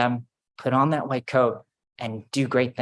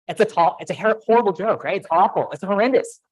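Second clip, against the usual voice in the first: normal talking voice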